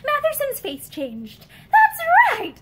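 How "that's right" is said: much higher and louder, triumphant; pleased. Acted. Husband triumphant as his wife gives in, high and loud. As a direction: crow with sudden satisfaction.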